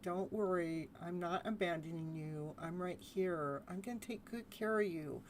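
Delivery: put-on soft, hushed promise to a child; soothing inner child. Shown as a demonstration. Adult self comforting the inner child, quiet and tender. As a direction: comfort gently, almost murmuring. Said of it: "I'm the adult and I'm here".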